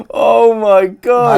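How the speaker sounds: high, loud, drawn-out exclamation; slow, loud scandalised cry; amused disbelief. Real, not acted. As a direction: stretch it out, reeling from the shock. Listener reeling after the punchline.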